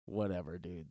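ordinary talk

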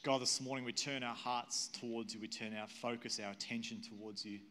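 earnest congregational prayer with long pauses; leading prayer. Real, not acted. Pastor praying to God for the congregation, earnest prayer. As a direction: pray aloud in measured, unhurried phrases.

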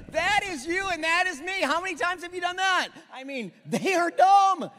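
preacher riffing loudly on the sheep video; excited, amused. Real, not acted. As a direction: keep the laughing energy going. Still laughing about the sheep clip.

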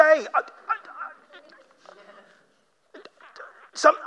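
stammering, broken attempts to answer; lost for words. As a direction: start and break off, speechless. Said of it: "Job doesn't know what to say".

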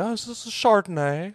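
lightly put-on voice, pitched well up; a hint of caricature, high and playful; mocking Cuomo. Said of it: "he's like".

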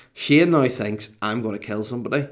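ordinary delivery

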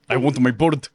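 mock villain with a put-on accent; Andy Cortez impression. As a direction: deliver it as an exaggerated movie impression. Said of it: "three Andy Cortez M C U impressions".

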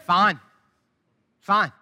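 short, clipped brush-off answer; a terse mock fine, repeated; curt fine. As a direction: shut the question down with one word. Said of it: "with words like this".

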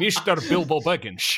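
put-on Scottish accent, "Mister" said as "Mishter"; hammed-up Scottish star impression with shushed s; Sean Connery. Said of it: "like, a Sean Connery thing".

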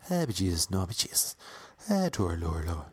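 lightly hammed leprechaun Irish lilt; Tom Cruise's Irish accent. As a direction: fumble through a fake Irish accent. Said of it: "my best Tom Cruise Far and Away".

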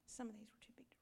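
whispered under the breath to the congregation; whispered aside. Real, not acted. Preacher whispers an aside to the church, hushed. Aside while reading scripture.

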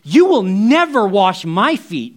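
loud, high NO, then slow insistence; emphatic refusal. Retold or imitated. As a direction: refuse outright at full voice. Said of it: "Peter speaks up and he says".

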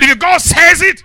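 high, slow preacher's shout; shouted proclamation. Real, not acted. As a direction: belt it out slowly to rouse the congregation. Repeats climax to congregation.

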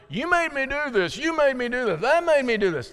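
high-pitched finger-pointing accusations; mock blaming. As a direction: pin it on everyone else, hammed up. Acting out blaming others for sin.

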